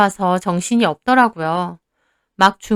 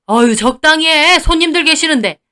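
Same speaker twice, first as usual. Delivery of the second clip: higher, louder annoyed rebuke; exasperated hushing; 6 semitones higher, 4 dB louder than usual. Acted. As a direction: tell the wife to stop. Father-in-law hushes his wife. (짜증스럽게 말리는 말투)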